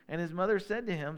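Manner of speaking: normal talking voice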